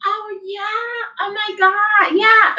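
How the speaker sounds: very high, sweet phone greeting; girly phone voice. Retold or imitated. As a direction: squeak it like a fake girly caller. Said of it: "sitting that phone".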